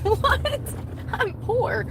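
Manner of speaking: very high squeaky what; high disbelieving protest; incredulous. Retold or imitated. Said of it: "I'm like".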